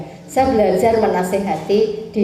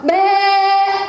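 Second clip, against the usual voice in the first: goat bleat; 8 semitones higher than usual. Retold or imitated.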